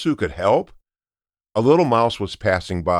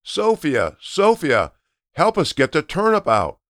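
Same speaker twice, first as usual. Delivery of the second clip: calling out; 7 semitones higher than usual. Acted. Grandparents calling Sophia to help pull, high call. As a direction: call her name across the yard. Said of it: "so they started to shout".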